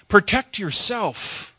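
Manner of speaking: a slow, high, pressing inner warning; a voice inside urging him to protect himself; urgent inner voice. Acted. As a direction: let the warning stretch out.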